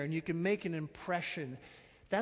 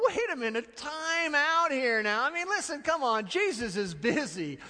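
mock-officious gatekeeper, louder and higher; imitating fussy disciples; 8 semitones higher, 5 dB louder than usual. Disciples shooing away parents, mock indignant. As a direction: shoo people off like a self-important aide. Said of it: "The disciples go".

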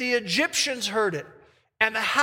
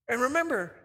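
ordinary delivery

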